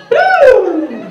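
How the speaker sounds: high, loud mock "Boo!"; mock scare shout. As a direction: jump out and shout it like a costumed intern. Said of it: "jumps out and goes".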